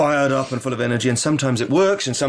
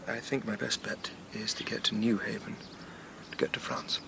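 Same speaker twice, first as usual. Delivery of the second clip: fading to a whisper; 12 dB quieter than usual. Acted. Curate dropping to a murmur.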